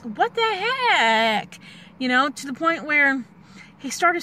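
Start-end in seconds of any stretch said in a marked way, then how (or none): 0.0-1.6 drawn-out, higher, bewildered what the heck. Retold or imitated. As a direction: stretch it out in mock disbelief. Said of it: "And I'd be like".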